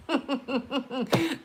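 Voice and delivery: quiet high chuckle; a short breathy laugh after the rant; soft high laugh. Real, not acted. After mocking her Canadian relatives, a soft high laugh.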